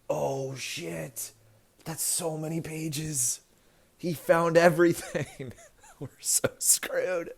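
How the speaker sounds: high, slow mock dread; panicked bros. Republicans panicking over the four hundred page report, high and slow.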